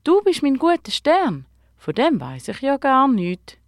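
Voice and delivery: put-on amazed child's question, easing off after the first line; astonished. A narrator's reading. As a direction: say it in wide-eyed wonder. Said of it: "Die Isabel staunet nicht schlecht".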